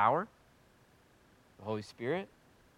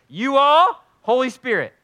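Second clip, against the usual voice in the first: calling out; 9 semitones higher, 9 dB louder than usual. Real, not acted. Assigning prayer sections.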